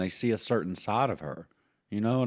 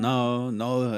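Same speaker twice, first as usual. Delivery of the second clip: ordinary delivery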